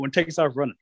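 usual speaking voice

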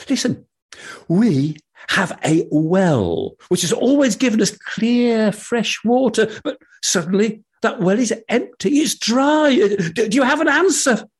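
high, pleading villager voice; pleading townsman. Acted. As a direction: beg for help, high and urgent.

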